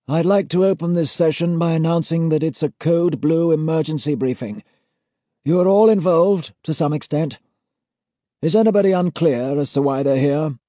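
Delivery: put-on high, loud ceremonial old gent; formal old auditor. A narrator's reading. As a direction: pronounce the seal with cold authority. Senior auditor figure.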